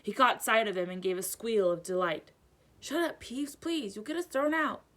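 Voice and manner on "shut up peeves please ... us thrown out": higher, hushed pleading; urgent plea. A narrator's reading. Harry pleads with Peeves.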